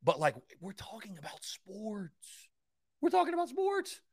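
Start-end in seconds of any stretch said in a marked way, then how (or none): 0.6-2.5 slow, softened, drawn-out emphasis; hushed disbelief. Acted. Host explaining why he can't be calm about sports, slow and hushed. Mock contrast with calm talk. 3.0-4.0 very high, squeaky-excited burst; high-pitched excitement. Acted. Host insisting sports deserve energy, high-pitched and excited.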